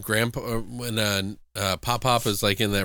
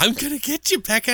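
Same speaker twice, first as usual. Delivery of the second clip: witch voice; 13 semitones higher than usual. Retold or imitated. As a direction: taunt her like a cackling witch. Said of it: "It was like a witch laugh".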